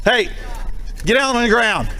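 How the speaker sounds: commanding shout. Real, not acted. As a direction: bark the order to get down. Officer orders suspect down.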